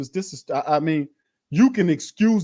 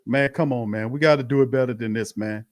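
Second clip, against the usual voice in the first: ordinary talk, nothing marked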